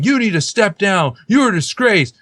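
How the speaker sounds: high, loud mock scream; mock outraged shout at a student; retold screaming. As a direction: yell it like an angry professor. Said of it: "screaming at him".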